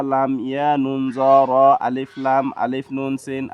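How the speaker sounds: ordinary talk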